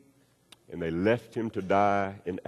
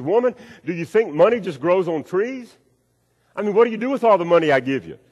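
higher, louder, fast indignant scolding; exasperated husband; 8 semitones higher, 6 dB louder than usual. Acted. Stingy husband scolding his wife for money, indignant. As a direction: bark it at her like a fed-up husband.

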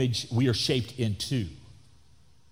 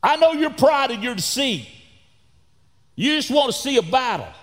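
accusing brother; 9 semitones higher, 5 dB louder than usual. Retold or imitated. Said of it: "then he attacked his character".

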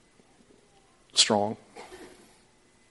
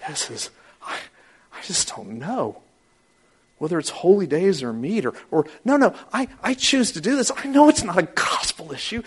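earnest worried believer; 10 semitones higher, 5 dB louder than usual. Retold or imitated. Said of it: "the guy that's going".

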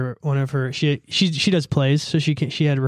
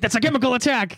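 higher-pitched catchphrase; imitated outburst; 7 semitones higher than usual. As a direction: repeat the show line in a put-on high voice. Repeating the quoted show line.

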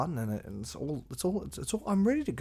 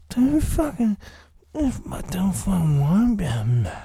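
much higher, much louder hammed-up yell; put-on yelling voice; 8 semitones higher, 9 dB louder than usual. Podcaster shouting his catchphrase to listeners, in a hammed-up yelling voice. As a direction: bellow it in a silly character voice.

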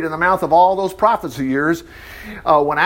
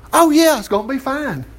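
higher-pitched, breezy fake assurance; mock yes-man prophet; 5 semitones higher than usual. Prophet coming in to promise the king victory, mock reassurance. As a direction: play up the easy promise of victory. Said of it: "When this guy comes in there, he goes".

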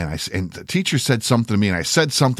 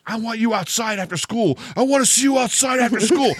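high challenge, pitched way up, imitating his teen self; retold scream; 11 semitones higher than usual. Teen challenging a classmate to fight, high mock scream. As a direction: call him out for a fight after school, straining high. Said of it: "And I'm fucking screaming it".

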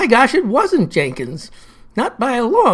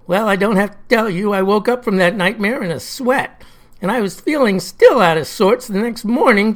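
ordinary talk

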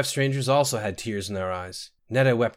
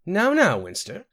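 gentle chiding; 9 semitones higher, 4 dB louder than usual. A narrator's reading. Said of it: "smiling at Sinetta apologetically".

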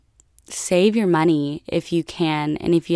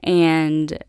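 ordinary talk, nothing marked

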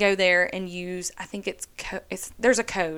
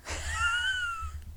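squealing laugh; 9 dB quieter than usual. Real, not acted. Laughs at mentioning Sarah again.

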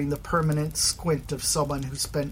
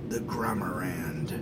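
lower, soft unvoiced whisper; 6 semitones lower, 5 dB quieter than usual. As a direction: breathe the name secretly. Scarab's private thought.

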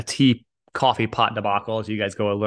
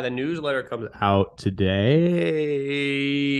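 drawn-out announcement; 3 semitones higher, much slower than usual. Acted.

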